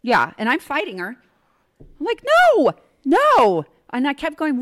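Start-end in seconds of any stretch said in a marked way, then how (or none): 2.5-3.7 loud, high mock yell; loud acted protest, pitched up; retold refusal. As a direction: shout it as if fighting someone off. Retold fighting her off.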